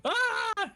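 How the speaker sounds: high mock scream of terror; terrified scream. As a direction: shriek like you just saw a tiger. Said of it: "screams in terror".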